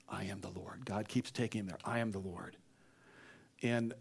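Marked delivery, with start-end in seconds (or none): 0.1-0.8 much softer and lower; hushed refrain. Acted. Moses returning back to this point, hushed refrain. 1.8-2.5 soft, low repetition; hushed refrain. Acted. God keeps taking Moses there, hushed refrain.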